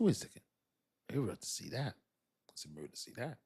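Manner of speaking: soft, puzzled murmur to himself; a hint of quiet puzzlement. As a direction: mumble it as it dawns. Retold slow realization.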